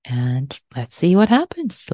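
normal talking voice

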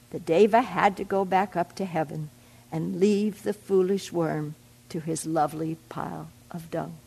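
usual speaking voice

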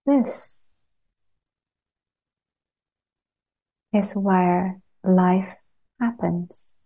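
calm meditation guide with long pauses between phrases; unhurried, evenly pitched guidance, leaving long silences; guided meditation. Acted. Teacher guiding a group to settle into body and earth, guided meditation.

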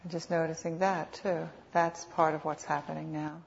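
unhurried meditation guide, long pauses between phrases; guided meditation. Real, not acted. Teacher guiding sitters to notice what is happening, calm meditation voice. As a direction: lead them gently, phrase by phrase, leaving space.

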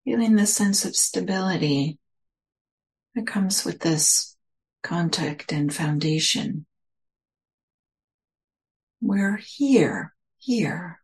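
measured meditation voice, phrase by phrase; guided meditation. Real, not acted. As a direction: guide listeners into stillness, leaving space between phrases.

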